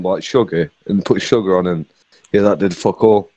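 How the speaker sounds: normal talking voice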